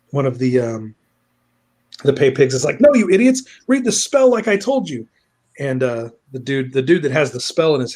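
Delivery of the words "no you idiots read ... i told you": high, loud exasperation; mock angry order. Pay pig berating his fellows, mock angry. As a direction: berate your bungling crew, hammed up.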